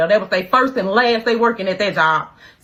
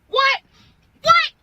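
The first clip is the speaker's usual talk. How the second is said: outraged surprise; 3 dB louder than usual. Retold or imitated. Told her friends jumped someone.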